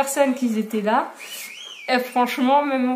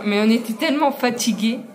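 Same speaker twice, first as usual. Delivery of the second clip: ordinary delivery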